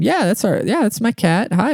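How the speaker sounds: put-on lifted, high-pitched girlfriend voice; imitated girlfriend. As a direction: imitate girlfriend answering a neighbor. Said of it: "And Shody's like".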